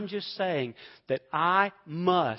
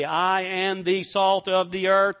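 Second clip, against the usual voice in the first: slow, louder declaration of "I am the salt of the earth"; open confession; 5 semitones higher, 11 dB louder than usual. Shown as a demonstration. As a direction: proclaim it out loud, slowly. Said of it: "I confess it openly".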